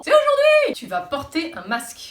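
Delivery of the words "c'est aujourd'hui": squealing with joy; ecstatic high shout. Retold or imitated. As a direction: shriek it with glee, much higher and louder. Friends mishear the reopening date.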